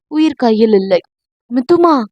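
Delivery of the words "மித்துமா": alarmed cry. A narrator's reading. Husband sees wife fall.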